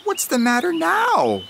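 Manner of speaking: a bird puzzled by the crying cub; high crow voice. A narrator's reading. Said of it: "asked Crow".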